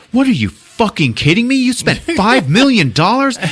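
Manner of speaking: high, loud disbelief; imitated incredulous shout. Friend hears what the movie has cost, incredulous shouting. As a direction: burst out in mock astonishment. Incredulous reply in retold quarrel.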